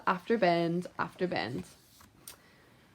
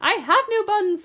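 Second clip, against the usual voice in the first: much higher, louder little squirrel voice; shivering squirrel; 12 semitones higher, 5 dB louder than usual. Acted. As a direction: squeak it, scared. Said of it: "the squirrel replied".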